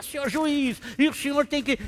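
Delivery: high, loud mock rant at the judge; mock angry outburst. Defendant ranting at the judge in court, mock angry outburst. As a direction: storm at the judge like a fool. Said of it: "começa a esmurrar".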